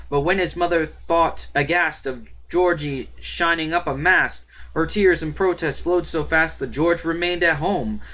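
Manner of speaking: high, loud declaiming of playful verse; poem recitation. Acted. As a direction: proclaim the verse, breaking in on it with protests. Reads a comic poem aloud.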